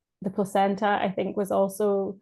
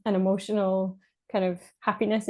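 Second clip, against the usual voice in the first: usual speaking voice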